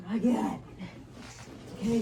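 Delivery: normal talking voice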